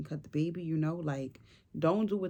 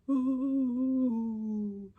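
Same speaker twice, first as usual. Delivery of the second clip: shivering ooh; 8 semitones higher than usual. Retold or imitated. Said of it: "If y'all see my video, I'm doing that".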